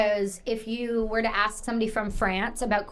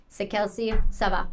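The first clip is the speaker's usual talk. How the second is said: Quebec French. Shown as a demonstration. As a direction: toss it off in Québécois slang. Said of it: "say it in Quebec".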